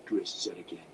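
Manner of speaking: ordinary delivery, nothing marked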